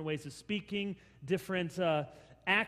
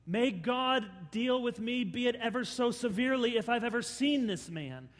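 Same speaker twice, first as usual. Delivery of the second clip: swearing a curse; 6 semitones higher, 6 dB louder than usual. Acted. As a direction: swear it with force. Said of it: "invoke a curse on himself".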